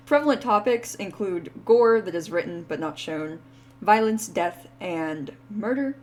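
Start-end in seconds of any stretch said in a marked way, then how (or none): none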